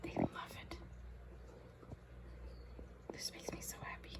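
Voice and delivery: barely audible whisper; hushed delight at the birds; wildlife watching whisper. Real, not acted. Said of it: "I'm whispering so I don't scare them".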